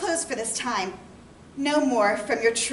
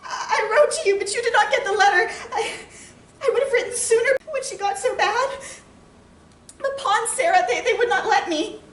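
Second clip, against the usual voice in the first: high, loud, tearful pleading; distraught; 8 semitones higher, 5 dB louder than usual. Acted. Wife explaining to her husband why word came late, distraught. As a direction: plead through sobs, stumbling over the words.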